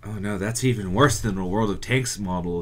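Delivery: ordinary talk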